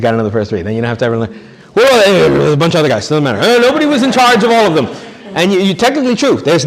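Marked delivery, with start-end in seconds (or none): 1.7-4.9 higher, louder put-on voice, waving it off; dismissive shrug. Priest brushing off later kings for the congregation. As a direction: shrug it off like it doesn't matter.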